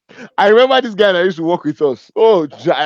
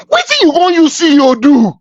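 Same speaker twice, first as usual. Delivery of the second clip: high, loud, exasperated comic wail; passionate outburst; 10 semitones higher, 6 dB louder than usual. Acted. Speaker fed up with everyone claiming CEO, passionate outburst. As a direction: lose all decorum, crying out in mock despair. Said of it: "I'm very passionate about this".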